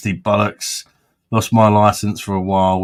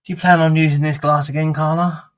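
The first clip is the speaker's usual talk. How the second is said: higher prim upper-class voice; posh voice; 7 semitones higher than usual. Pretend. Asking Carla about her glass, in posh voice. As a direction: sound like a fussy aristocrat asking a favour. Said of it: "Sounds rather posh".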